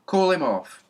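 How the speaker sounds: high, loud order across the yard; loud call. A narrator's reading. Policeman calling to the boy about his dog, loud. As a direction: bark the command at the boy. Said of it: "he called to the boy".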